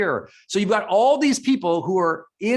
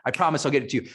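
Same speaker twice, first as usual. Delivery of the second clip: usual speaking voice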